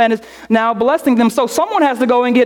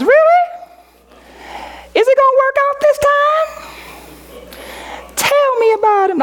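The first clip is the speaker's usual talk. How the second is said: eager disbelief; 14 semitones higher, faster than usual. Acted. Single friend hearing of a match, high squeal.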